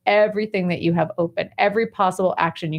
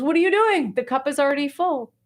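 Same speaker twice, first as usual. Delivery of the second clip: shocked outburst; 9 semitones higher than usual. Acted. Young monk crying out at the old monk as tea spills, alarmed and high. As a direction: yelp as hot tea hits your lap. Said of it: "And he goes".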